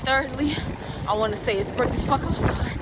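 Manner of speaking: excited. Real, not acted. Outdoor vlog intro, raised excited voice.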